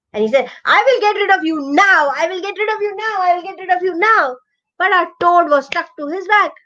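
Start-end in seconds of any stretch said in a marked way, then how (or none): 0.7-4.4 higher, threatening crocodile; angry crocodile. Acted. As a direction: repeat the threat, getting rid of him. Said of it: "he got so angry".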